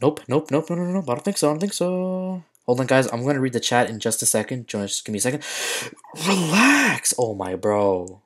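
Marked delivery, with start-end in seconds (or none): 0.0-2.4 high rapid nopes, higher; panicked denial. Real, not acted. Tense match, streamer squeals denials at opponent, higher. 6.3-7.1 high sharp relax; sudden yell. Real, not acted. Streamer yells at game opponent, high and sharp.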